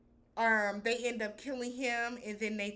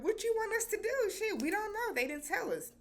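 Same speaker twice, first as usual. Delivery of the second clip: high squeaky mock exasperation; exasperated guards; 12 semitones higher than usual. Masked guards answering the freaked-out doctor, high exasperated squeak. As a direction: throw your hands up, pitched way up. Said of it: "they were like".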